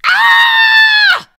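shocked shout. Acted. Finds cheesy puff stains.

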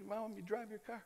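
very soft imitated voice; little old car dryer. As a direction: murmur the offer in character. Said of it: "and they".

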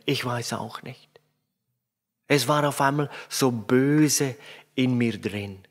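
put-on understated whimper of a sorry child; mildly weepy child. Girl on her father's lap, mildly weepy. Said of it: "mit weinerlicher Stimme".

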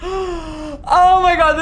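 slow laughing half-cry; laughing relief. Real, not acted. Streamer relieved the cat is safe, laughing and half crying.

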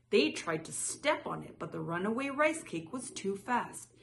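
plain reading voice

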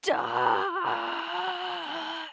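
long, high screamed word; full-blast character shout; drawn-out battle yell. Acted. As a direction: scream it and hold the vowel. Acted character's battle cry.

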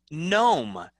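"gnome" said as nome, no G; "gnome" with the G left out; silent G. Shown as a demonstration. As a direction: start "gnome" straight on the N. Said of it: "No G sound".

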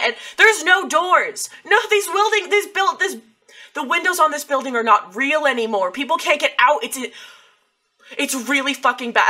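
loud, high, urgent plea; worked-up wife pressing for help; alarmed urging. Acted. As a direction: raise your voice as the town falls apart.

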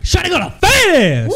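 loud hollered catcall, way up high; shout. Real, not acted. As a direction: yell it across the street. Hollering at a passing woman.

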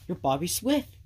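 usual speaking voice

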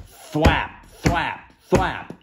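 punchy, high thwaps; sharp storybook sound effect; imitated wing beats. A narrator's reading. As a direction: make each wing beat pop. Acting out beating wings in story.

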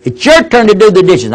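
put-on squabble like a teenage girl; mock arguing daughter. Daughter arguing with her sister over dishes, high bickering. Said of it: "daughters raising their voices".

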